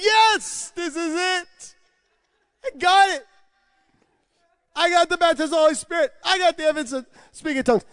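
high, loud, fast triumphant cry; ecstatic shouting. Retold or imitated. Overjoyed at getting the baptism, shouting high and loud. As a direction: shout with joy that you got it.

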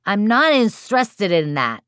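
put-on high, stubborn little kid; recalcitrant toddler. A narrator's reading. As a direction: turn it down like a defiant toddler. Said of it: "Recalcitrant two and a half year old".

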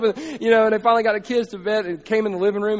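ordinary talk, nothing marked

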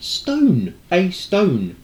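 high, loud, emphatic "Stone"; retold as if spelling out the obvious; exasperated repetition. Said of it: "I just kept repeating stone".

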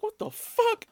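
high, loud mock shock; shocked outburst. Stopped by police, friend yells in shock. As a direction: blurt it out, way up high.

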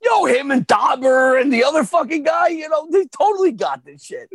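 high, loud fan hype; retold excited confidence. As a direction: rave about the team, half shouting. Acting out excited faith in Coach.

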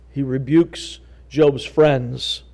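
usual speaking voice

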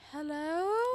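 high rising mock hello; waiting customer hello. Customer imitating herself calling at the window, rising. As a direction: call out like you've been forgotten. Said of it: "I'm always like".

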